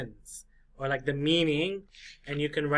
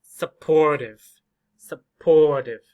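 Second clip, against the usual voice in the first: supportive with a much louder, longer POR; stress on POR; 8 dB louder, slower than usual. Shown as a demonstration. Teacher demonstrates stress, supportive slow with booming middle syllable. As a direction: hit and hold POR in supportive. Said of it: "Stress is the loud or long syllable".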